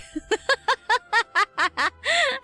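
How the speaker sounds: bright high laughter at her own joke; amused laugh at the Xiangling joke. Real, not acted.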